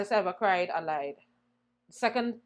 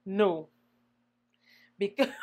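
ordinary talk

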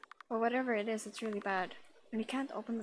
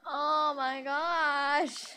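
surprised; 3 semitones higher, 5 dB louder, much slower than usual. Real, not acted. Gasp at a surprise.

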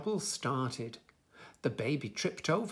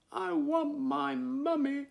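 put-on high, drawn-out baby wail; crying out; 12 semitones higher, 4 dB louder, slower than usual. A narrator's reading. Lost baby in the rain calling for a mummy, crying out. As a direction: wail it slowly on a high held pitch. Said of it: "he cried".

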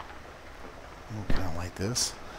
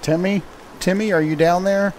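calling down a hallway; 7 semitones higher, 4 dB louder than usual. Pretend. Calling for Timmy below.

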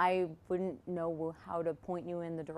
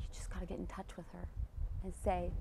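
whispering; 7 dB quieter than usual. Real, not acted. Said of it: "whispered coaching".

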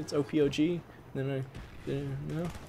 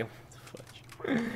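breathy hushed disbelief, slow; whisper; 10 semitones higher, 10 dB quieter, much slower than usual. Real, not acted. Streamer reacting to a baffling teammate, slow whisper. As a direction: mutter it under the breath, drawn out.

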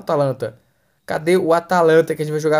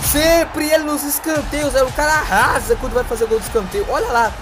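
excited; 6 semitones higher, 3 dB louder than usual. Real, not acted. Excited after goal.

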